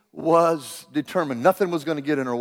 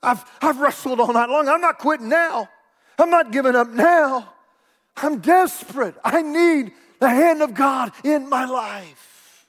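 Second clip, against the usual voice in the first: raised, high preacher's cry; desperate determination; 6 semitones higher, 4 dB louder than usual. Acted. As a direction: declare it as a worn-out wrestler refusing to quit. Voices Jacob's desperate persistence.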